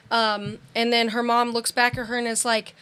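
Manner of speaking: ordinary talk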